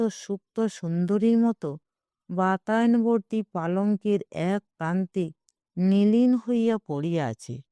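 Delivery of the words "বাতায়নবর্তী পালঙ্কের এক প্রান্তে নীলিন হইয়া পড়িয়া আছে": ordinary delivery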